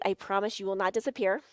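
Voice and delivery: ordinary talk, nothing marked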